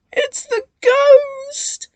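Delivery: very high, slow frightened wail; terrified cry. A narrator's reading. Twins hearing the ghost, crying in terror. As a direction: scream it in terror. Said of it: "they cried".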